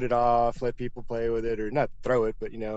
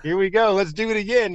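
pumped up; 7 semitones higher, 4 dB louder than usual. Retold or imitated. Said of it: "my heart starts racing".

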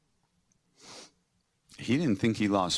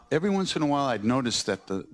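ordinary talk, nothing marked

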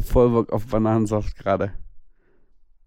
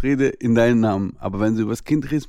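ordinary delivery

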